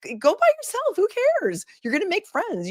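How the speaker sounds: high, bright push to just go; eager encouragement. Retold or imitated. Streamer urging a fan to go alone, excited and high. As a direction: cheer them on to go solo.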